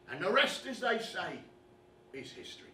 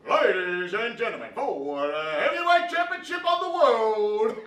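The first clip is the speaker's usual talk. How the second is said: ring announcer; 3 semitones higher, 11 dB louder than usual. Acted. Boxing fan playing announcer for the big title fight, booming. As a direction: announce the title bout to the whole arena.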